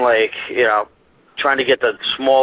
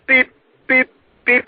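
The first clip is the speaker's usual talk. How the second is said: high, widely spaced beeps; slowed-down beeping; 12 semitones higher, slower than usual. Retold or imitated. Storyteller mimicking the mystery beep as it slows, high spaced-out beeping. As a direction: mimic a signal beep slowing right down. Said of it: "It slows down".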